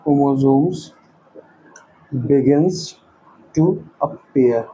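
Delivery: low, slow, word by word; slow dictation. Real, not acted. Teacher writing notes for students, slow dictation. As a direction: leave long gaps between words as you write.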